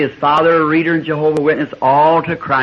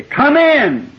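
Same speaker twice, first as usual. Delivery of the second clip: high called-out come in; calling out; 11 semitones higher, 3 dB louder than usual. Retold or imitated. Southern host inviting any walker inside, high ringing call. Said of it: "You'd say".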